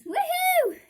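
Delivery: high cheery woohoo; excited whoop. Real, not acted. Signing off the video, high excited whoop. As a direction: cheer at the sign-off.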